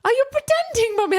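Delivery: put-on high, loud child voice, puzzled; a small son asking his mum; innocent little boy. Son questioning crying mum.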